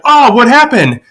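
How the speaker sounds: high-pitched startled question; mock alarm. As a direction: imitate a husband startled by a scream. Dad startled by mom's scream.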